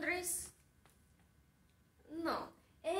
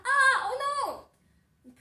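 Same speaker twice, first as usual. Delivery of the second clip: high, loud frightened scream; 10 semitones higher, 12 dB louder, much slower than usual. Acted. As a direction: cry out in alarm, drawn out and very loud. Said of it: "Andrés grita". (grito de susto)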